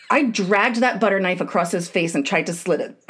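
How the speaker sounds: ordinary talk, nothing marked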